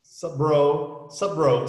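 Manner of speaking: loud, casual "Sup, bro"; punchy clipped slang hello; street greeting. Acted. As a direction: greet a buddy from the block. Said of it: "Principalmente em guetos".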